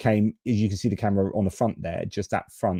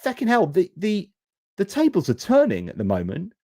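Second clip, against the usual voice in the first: shocked inner voice; 8 semitones higher than usual. Retold or imitated. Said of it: "And you're thinking".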